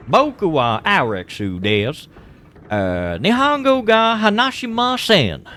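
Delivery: loud, high, exaggerated American-accented Japanese; thick American accent. Anime meme of an American speaking Japanese, thick American accent. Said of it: "an American speaking, it'd be like".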